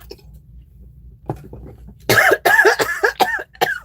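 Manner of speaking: high, rasping choking cough fit; water down the wrong pipe, cough hard; violent choking cough. Real, not acted. Choking on water while filming, violent cough. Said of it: "I almost choked on my water".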